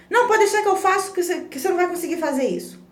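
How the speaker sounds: put-on snatch the task away from the child; overprotective parent. Mãe superprotetora imitada. (mãe superprotetora, rápida e aguda)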